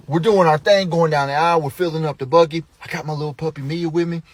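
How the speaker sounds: normal talking voice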